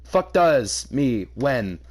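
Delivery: ordinary talk, nothing marked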